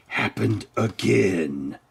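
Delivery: ordinary delivery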